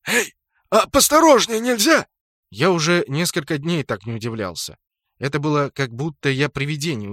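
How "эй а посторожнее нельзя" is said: high, loud indignant yelp; indignant protest. A narrator's reading. Orc tumbles from a kicked barrel, indignant protest. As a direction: protest loudly, pitched well up.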